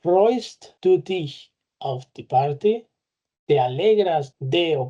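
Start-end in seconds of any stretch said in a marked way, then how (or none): 0.0-2.9 slow, careful German example; deliberate teacher pace; slow model sentence. Shown as a demonstration. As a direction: read it slowly for learners. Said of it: "como aquí".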